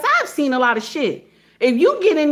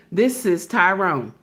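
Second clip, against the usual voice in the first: low-pitched imitation of Tyrone answering; Tyrone on the phone; 6 semitones lower than usual. Office phone pickup by Tyrone, mimicked low. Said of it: "they say just like this".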